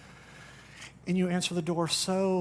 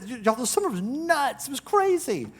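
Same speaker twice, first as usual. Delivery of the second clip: lively summer complaint; 8 semitones higher than usual. Pretend. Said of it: "We just say".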